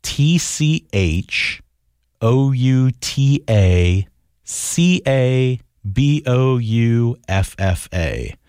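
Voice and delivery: letters spelled out very slowly, one by one; careful slow letter-by-letter spelling. Shown as a demonstration. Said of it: "listen very closely".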